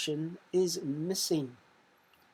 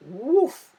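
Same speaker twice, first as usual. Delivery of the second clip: higher, much louder woof like a dog; barking woof; 8 semitones higher, 11 dB louder than usual. Shown as a demonstration. Teacher giving the dog answer to pupils as a loud bark.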